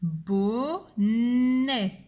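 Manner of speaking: "Bonnet" as long bo, long nnnè; slow dictation, leaning on the n; syllable by syllable. Shown as a demonstration. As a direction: draw out both syllables of "Bonnet". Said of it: "qui fait le son".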